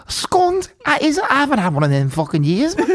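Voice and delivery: a hint of a Liverpool accent, amused; imitating a Liverpool cabbie; mock Scouse taxi driver. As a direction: retell the Scouse driver's line half laughing. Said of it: "Your accent".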